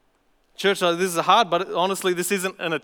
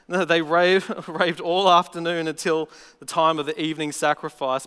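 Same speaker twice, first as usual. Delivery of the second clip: ordinary talk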